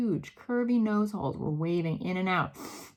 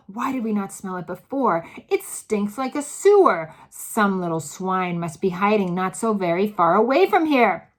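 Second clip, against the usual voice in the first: loud outraged; 8 dB louder than usual. A narrator's reading. Witches ranting about the hidden child, loud. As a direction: rant at the stink.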